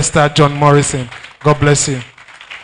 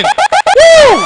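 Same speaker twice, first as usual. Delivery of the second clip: very high, loud whoop; hype whoop; 7 dB louder than usual. Real, not acted. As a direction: let out a loud whoop to the crowd. MC hyping the crowd.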